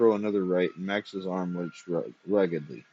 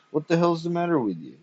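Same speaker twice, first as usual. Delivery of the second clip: higher, voice breaking mid-sentence; voice cracking; 7 semitones higher than usual. A narrator's reading. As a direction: push the words until the voice cracks. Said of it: "His voice cracked in the middle".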